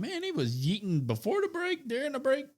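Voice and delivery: high, disbelieving exclamation; incredulous thought. Retold or imitated. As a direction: squeal it out in mock disbelief. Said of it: "I thought".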